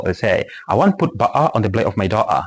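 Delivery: ordinary delivery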